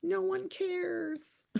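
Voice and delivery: high, soft, sarcastic fake pity; mother mocking her sore son with soft fake pity; mock sympathy. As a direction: coo it sweetly then deadpan no one cares. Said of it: "I'm like".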